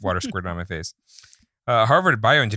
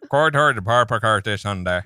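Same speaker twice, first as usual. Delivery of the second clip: put-on slow, thick Missouri accent on "Quad Hard Par Par Cars de Donda"; a slowed, exaggerated Missouri mumble; 3 dB louder, slower than usual. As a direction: repeat it deliberately in a mushy Missouri accent. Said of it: "That's my Missouri accent".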